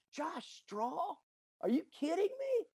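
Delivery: high, incredulous double take; can't believe what he's hearing; stunned disbelief. Retold or imitated. Boss on the phone hearing shy Josh will lead book club, stunned and incredulous.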